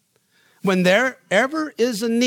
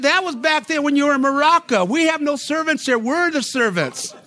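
higher, louder, slowing on the last words; high raised voice, laughing at the end; mock rebuke; 8 semitones higher, 4 dB louder than usual. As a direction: put him in his place with a grin. Retold rebuke of Steve.

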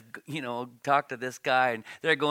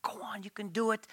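a soft urging whisper, higher; whispered encouragement; 6 semitones higher, 5 dB quieter than usual. Retold or imitated. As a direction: whisper it to the kids on the ice. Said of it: "I get my kids going".

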